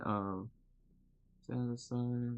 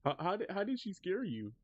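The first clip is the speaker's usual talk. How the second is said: high teasing voice; 9 semitones higher than usual. Real, not acted. Keeps teasing about the bot.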